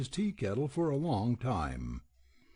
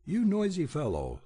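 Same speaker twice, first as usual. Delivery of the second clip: scolding; 6 semitones higher, 3 dB louder than usual. Acted. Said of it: "scolded the old priest".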